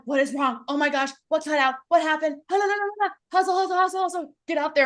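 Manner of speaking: high, loud panicky rush; retelling her bathroom panic to the audience, high and frantic; panicked. Retold or imitated. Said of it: "And I'm like".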